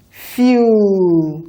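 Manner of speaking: "Fuel" drawn out slowly, sounds stretched apart; slow segmented blending of "Fuel". Shown as a demonstration. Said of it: "A bit faster now".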